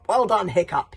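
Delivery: loud proud cheer; exultant. Acted.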